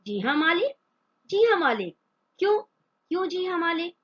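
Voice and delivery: spluttering in fury, mocking her reply; stammering with anger. A narrator's reading. Employer furious at the maid's meek reply, stammering. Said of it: "गुस्से से तुतलाने लगता है".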